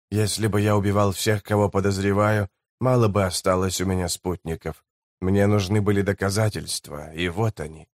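low, soft grim voice of a hardened guide; a quiet vow of revenge, voiced low; low, soft, bitter scout; grim lowlander.

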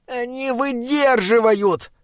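high, drawn-out moan; slow, pleading wail; groaned protest. A narrator's reading. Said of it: "простонал Бинобик".

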